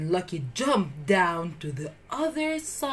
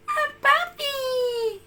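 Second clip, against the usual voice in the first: put-on high, drawn-out squeal of a little girl; little girl spotting a puppy; delighted girl; much slower than usual. A narrator's reading. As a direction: gasp with delight. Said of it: "she exclaimed".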